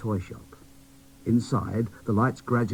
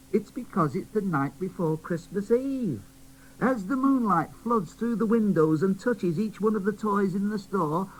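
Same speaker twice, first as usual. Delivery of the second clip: high, eager storyteller toy; Jack in the Box; 11 semitones higher than usual. Acted. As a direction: explain the magic.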